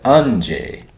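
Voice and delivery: "anje" with the weight on the first syllable; first syllable stressed. Retold or imitated. Reviewer copying the man's first try, "anje" stressed up front. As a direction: lean on the opening "an" of "anje". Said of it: "the first time he said".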